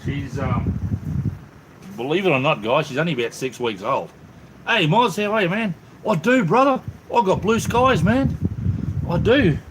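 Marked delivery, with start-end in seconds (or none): 4.7-9.6 high-pitched, upbeat hello to a mate; excited greeting. Real, not acted. Streamer greets a viewer about blue skies, excited. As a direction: sing out the good news, high and lively.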